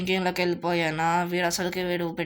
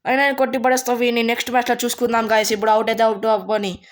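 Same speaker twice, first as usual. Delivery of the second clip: loud, fast, fired-up boast; defiant vow; 6 dB louder, faster than usual. Real, not acted. Player vows to viewers he'll win next match, defiant. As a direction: rush it out with defiant force.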